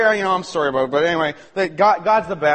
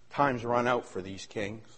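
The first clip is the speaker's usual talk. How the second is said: ordinary talk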